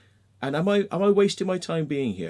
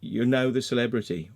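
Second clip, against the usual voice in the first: ordinary talk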